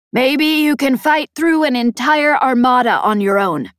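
high, forceful voice over the comm; Peg warning. Acted. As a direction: grant the point forcefully as Peg. Peg's character voice in narration.

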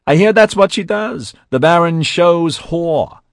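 loud, high jeering taunt; triumphant sneer. A narrator's reading. J C taunts Melanie before the bodyguards, sneering triumphantly. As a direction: crow it loudly as an insult. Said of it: "His sneer turned triumphant".

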